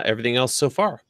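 ordinary delivery

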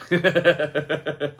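quick embarrassed chuckle at himself; short real laugh. Real, not acted. Reviewer laughing about buying the same knife again, brief real chuckle. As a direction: laugh it off at his own splurge.

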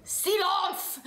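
mock bark of a high-pitched strict professor; stern professor. Professor silencing a noisy class, high mock bark. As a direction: squeak out a strict teacher's command, laughing. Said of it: "our professors would have just said".

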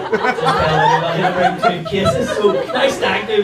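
high laughing line; laughing while talking. Real, not acted. Laughing out the mate's plan to the crowd. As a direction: squeeze it out through laughter.

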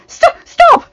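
high, loud, panicked stammer; sudden high-pitched plea to stop; distressed cry. A narrator's reading. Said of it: "Belle stammered in distress".

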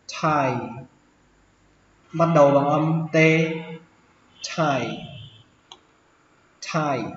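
"tie" with no e sound, like "tai"; silent e. Shown as a demonstration. Teacher showing learners the silent e in "tie". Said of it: "ở đây không có âm e".

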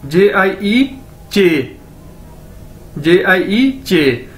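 जे आई ई चे spelled letter by letter, slowly; slow spelling out. Shown as a demonstration. Teacher spelling out jie for the class, slowly. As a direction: spell J I E, then land on चे. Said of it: "चे, ठीक है".

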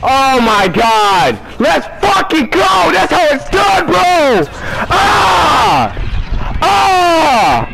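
victory yelling. Real, not acted. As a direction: shout it out, pumped. Celebration continues, yelling.

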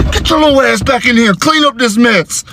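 put-on high-pitched dad ordering a child; exasperated scolding. Dad calling his daughter back, high exasperated scolding.

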